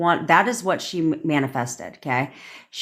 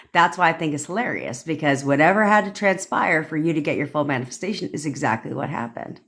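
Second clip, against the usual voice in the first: usual speaking voice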